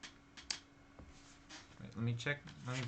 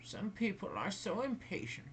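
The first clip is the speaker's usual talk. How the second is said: muttered; 9 semitones higher than usual. Acted. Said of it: "she muttered".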